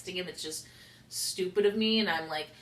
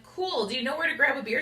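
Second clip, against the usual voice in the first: pitched up and eager; eager question; 8 semitones higher, 4 dB louder, faster than usual. Retold or imitated. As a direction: ask keenly, voice lifted high. Asks new friend about beer.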